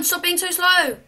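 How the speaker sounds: usual speaking voice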